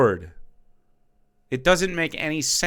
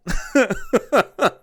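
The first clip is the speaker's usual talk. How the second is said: scoffing laugh; 8 semitones higher than usual. Real, not acted. Laughs at own point.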